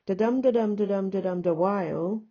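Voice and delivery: loud "da-dum" chant landing on "while"; iambic rhythm. Shown as a demonstration. Teacher sketching third rhymed line, iambic rhythm. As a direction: punch each "dum", keep "da" light, rise on "while". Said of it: "like this and say".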